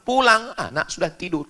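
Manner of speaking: normal talking voice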